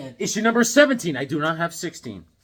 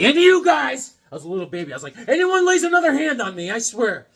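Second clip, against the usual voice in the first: much higher and louder mock tough threat; newborn threat; 7 semitones higher, 6 dB louder than usual. Newborn threatening the nurse, high and loud. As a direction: threaten them as the tough baby.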